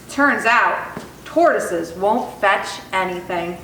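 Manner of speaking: ordinary delivery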